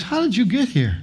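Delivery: high-pitched incredulous question; acting out a baffled angel, pitch jumping up; mock exasperation. Angel supervisor giving up on doctrine questions, exasperated.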